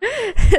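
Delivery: machiavellian laugh; evil villain cackle; mustache twirling villain. Acted. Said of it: "C'est quoi ce rire machiavélique?".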